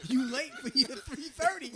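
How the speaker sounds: loud, slow, laughing through it; laughing mock scold. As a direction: tease him like a strict receptionist. Imitating cashier, laughing.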